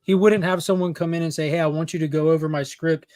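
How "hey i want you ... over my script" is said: normal talking voice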